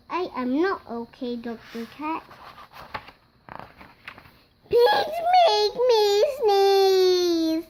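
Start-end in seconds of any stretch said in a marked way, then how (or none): 4.7-7.6 loud, slow, high wail with a sob and a laugh; hammed-up sobbing lament; comic wail. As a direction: wail it out in mock despair. Despairing confession.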